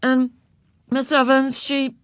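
put-on high, halting girl's voice; start, pause and falter; hesitant. A narrator's reading. Beezus beginning her confession to Miss Evans, hesitant and high. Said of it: "went on hesitantly".

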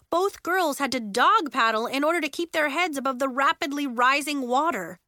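ordinary delivery

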